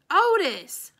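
high, loud holler. A narrator's reading. Opal hollering to Otis over the rain, loud high call. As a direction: call his name across the rain. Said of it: "I hollered at him over the rain".

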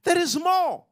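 rising pulpit delivery; preaching. Acted. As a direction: lift the church with it. Preaching build over music.

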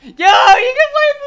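very high, very loud shriek; ecstatic scream. Real, not acted. Fan discovering a playable zombie, ecstatic scream. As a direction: scream it in thrilled amazement. Amazed by a new playable character.

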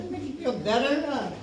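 normal talking voice

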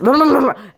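loud, high rolled babble; silly babbling noise. Shown as a demonstration. Shows the plush group their noise, silly babble. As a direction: let out a burst of babbling. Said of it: "I do this".

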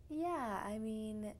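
ordinary talk

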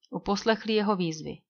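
ordinary delivery

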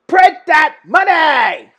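high, slow, drawn-out shout; escalated cheer. Real, not acted. Fan urging networks to cash in, slow loud hype shout. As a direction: stretch it into a big hype yell.

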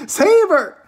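high, loud scream for help. Acted. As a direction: scream it as a frantic mother. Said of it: "screamed Missus Salt".

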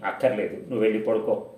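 ordinary delivery

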